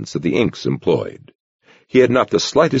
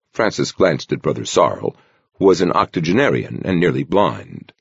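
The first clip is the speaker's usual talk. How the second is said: normal talking voice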